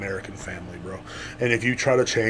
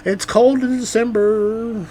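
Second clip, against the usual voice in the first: high, slow mocking singer voice; mock a swooning pop singer; mock girly singer; 10 semitones higher, much slower than usual. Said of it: "She's like".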